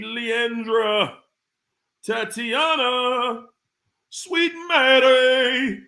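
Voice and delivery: slow, stretched sing-song name call; drawn-out greeting. Real, not acted. Host greeting viewers one by one, drawn-out call.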